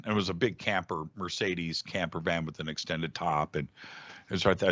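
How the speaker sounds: normal talking voice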